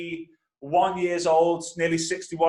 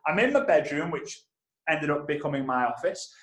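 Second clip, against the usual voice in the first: ordinary delivery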